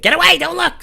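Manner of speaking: put-on high, loud childish cry; kid shooing him away. Ten-year-old hiding her writing from him, high and loud. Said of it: "she'd be like".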